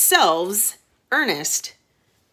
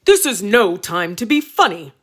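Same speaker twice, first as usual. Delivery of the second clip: louder, mock-angry king; roaring king; 3 semitones higher, 6 dB louder than usual. King roaring at his son, louder mock-angry voice. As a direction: bellow it like an angry king, hammed-up. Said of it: "roared His Majesty".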